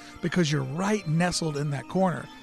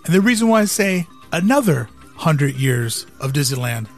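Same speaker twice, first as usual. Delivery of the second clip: dramatic speech; 5 dB louder than usual. Acted. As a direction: deliver it like a keynote pitch. Dramatic visionary monologue over music.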